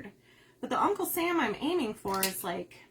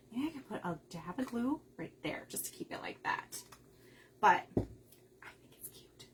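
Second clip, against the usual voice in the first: whispered musing; 7 dB quieter than usual. Real, not acted. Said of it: "whispered thinking aloud".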